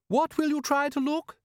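high, stern wife voice; bossy wife. A narrator's reading. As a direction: quiz him like a nagging wife. Wife voiced, scolding husband.

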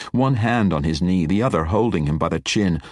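ordinary delivery, nothing marked

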